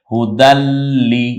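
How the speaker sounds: হুদাল্লি with a held double l, slow and loud; doubled consonant. Shown as a demonstration. Teacher showing tashdid after tanwin, doubled consonant. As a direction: lean on the ল্ল in হুদাল্লি and draw it out. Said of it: "তানভিন থেকে একটা নিয়ে বলতে হয়".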